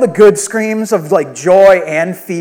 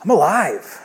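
acted relief; 7 dB quieter, slower than usual. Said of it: "there's this sense afterwards".

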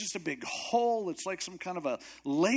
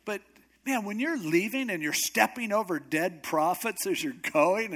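normal talking voice

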